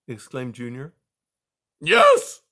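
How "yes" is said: full-throated scream, much higher and louder. A narrator's reading. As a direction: scream it at the top of his voice. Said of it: "screamed Aerith at the top of his voice".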